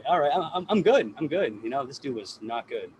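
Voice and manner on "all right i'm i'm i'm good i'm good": mock reassurance.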